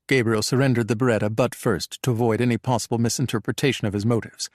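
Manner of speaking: usual speaking voice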